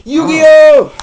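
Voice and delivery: high, roaring cry of Yu-Gi-Oh; loud shout. Acted. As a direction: yell the title like the anime. Shouting the show name.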